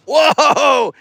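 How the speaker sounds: loud, high exclamation; surprised cry at a tumble; startled whoa. Real, not acted. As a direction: break off with a sudden loud whoa. Nephew falls over mid-sentence.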